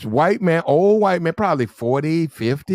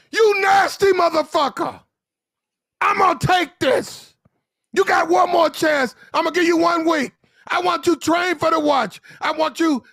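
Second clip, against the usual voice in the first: mock officer yelling; 11 semitones higher than usual. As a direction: bellow at the sailor like a furious lieutenant. Lieutenant shouting at sailor.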